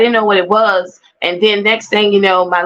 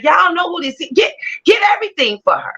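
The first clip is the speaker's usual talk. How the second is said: commanding boss; 8 semitones higher, faster than usual. Retold or imitated. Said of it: "she was moving like".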